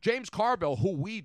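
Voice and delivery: high, loud Cajun voice; Cajun accent. Shown as a demonstration. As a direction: boast like a Cajun storyteller. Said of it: "I'm doing Cajun first".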